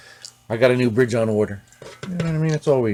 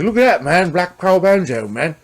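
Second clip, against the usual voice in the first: excited [name]; 8 semitones higher, 5 dB louder than usual. Retold or imitated. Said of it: "[name] might have picked".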